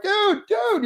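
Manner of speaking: high-pitched, excited "dude" on spotting a buddy; excited greeting. Retold or imitated. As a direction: squeal it like you just spotted an old pal. Excitedly greeting a friend at game.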